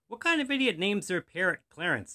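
high, loud scornful question; sneering. A narrator's reading. Burglar mocking the parrot's name, sneering. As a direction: throw it out as a sneer. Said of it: "the burglar sneered".

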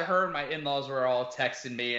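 ordinary talk, nothing marked

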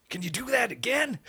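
high, amazed coach; lightly voiced coach asking. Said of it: "The coach says".

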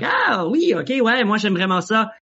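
bubbly high energy character voice; happy-go-lucky Charlie. Acted. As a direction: en français, voix aiguë et enjouée. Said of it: "sometimes my voice goes up".

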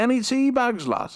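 mock Yorkshire accent. Shown as a demonstration. Continuing mock Yorkshire bit.

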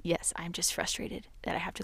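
soft unvoiced whisper; hushed whisper to a child; whispered reply. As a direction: whisper it gently, almost under breath. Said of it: "And I'll say".

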